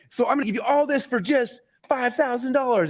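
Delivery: high-pitched mock seller announcing a price; acted sales pitch. Speaker sharing the price after stacking bonuses, showy pitch. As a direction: put on a showy price reveal. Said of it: "So you're like".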